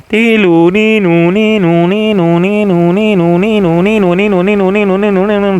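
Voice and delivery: high, loud siren ninu ninu; siren impression. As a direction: wail like an ambulance siren. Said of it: "Sirenya mana dong?".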